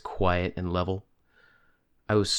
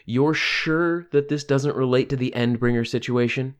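raised, pressing question, louder and higher; 7 semitones higher, 5 dB louder than usual. Acted. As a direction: speak up so the whole table hears. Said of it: "Louder, she said".

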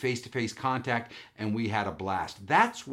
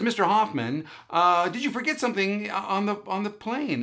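high-pitched imitation of a phone agent; lovely voice; 9 semitones higher than usual. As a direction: play the pleasant airline caller, pitched up. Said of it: "was a lovely voice".

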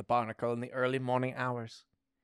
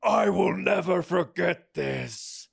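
slow, high, loud declaration; badly faked whisper at shouting volume; 5 semitones higher, 5 dB louder, slower than usual. A narrator's reading. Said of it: "That was a loud whisper".

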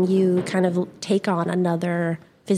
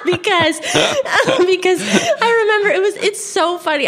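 laughing hard, higher-pitched; cracking up; 12 semitones higher, 4 dB louder than usual. Real, not acted. Remembering dad booing at a county fair, cracking up. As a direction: try to talk through a real laughing fit.

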